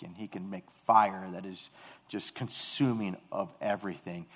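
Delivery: ordinary delivery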